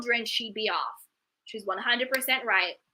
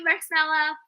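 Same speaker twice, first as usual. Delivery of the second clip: ordinary delivery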